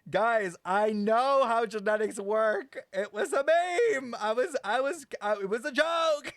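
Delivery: loud, high, laughing insistence; almost shouting, giggling defensively; laughing loud protest. Real, not acted. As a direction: insist it was a joke through laughter.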